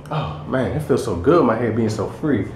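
ordinary delivery

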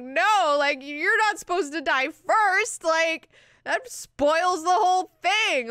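high, loud mock tantrum; indignant, hammed-up protest for the demon; super bummed. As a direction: whine loudly that it's all been ruined. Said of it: "he's super, super bummed".

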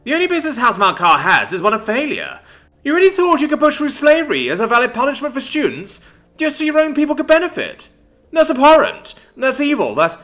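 high, loud, outraged rant; angry tirade. A narrator's reading. Luvia denounces Isadora for pushing slavery, furious tirade. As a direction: berate a rival at full voice. Luvia keeps berating her rival.

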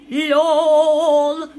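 loud, long held single note; mock yell. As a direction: blare it out and hold it. Mock reaction shouted in game.

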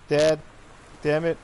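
calling loudly for dad. Real, not acted. Player calling for dad in the house, loud call.

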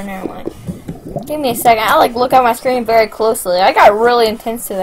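usual speaking voice

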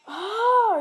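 sudden shocked oh; startled. Real, not acted. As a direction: jump at the shock. Door open, sudden shock.

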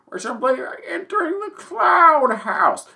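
high ancient sage voice; old wise cloud. Pretend. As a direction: keep the grand elder cloud tone. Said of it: "I'm Papa Cloud".

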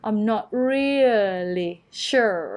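"I'm not really sure" slowly, REE drawn out; stress on REALLY. Shown as a demonstration. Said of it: "เน้นเสียงหนักที่พยางค์แรก".

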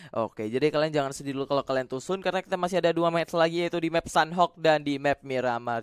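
usual speaking voice

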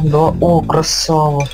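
ordinary delivery

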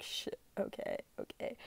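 hushed whisper to herself; whispered inner panic. Retold or imitated. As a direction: whisper it like a private freak-out.

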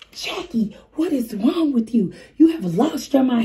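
high, mock-outraged scolding; imitating livid dad. Dad scolding her for leaving the baby outside, mock outrage. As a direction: play the furious young dad, a bit hammed-up. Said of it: "he is livid".

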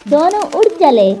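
usual speaking voice

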